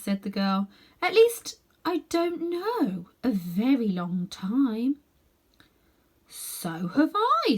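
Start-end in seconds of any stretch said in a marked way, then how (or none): 1.0-5.0 put-on high, slow girlish voice; a half-asleep young girl; dreamy girl. A narrator's reading. Girl's voice in story. 6.3-7.6 put-on higher, slow boyish voice; a lightly voiced drowsy boy; dreamy boy. A narrator's reading. Boy's voice in story.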